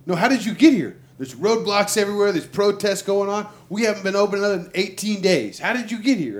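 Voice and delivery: loud, high, demanding owner; incredulous owner. Retold or imitated. Harley dealership owner demanding how the rider got in. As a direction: press him hard on how he got there.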